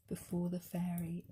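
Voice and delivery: ordinary delivery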